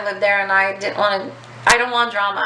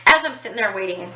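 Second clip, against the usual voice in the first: ordinary talk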